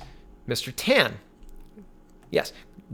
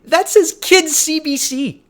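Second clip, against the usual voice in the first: slow, high, loud disbelief; stunned disbelief; 12 semitones higher, 7 dB louder, slower than usual. Real, not acted. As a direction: drag it out in shock. Shocked at a kids' news clip.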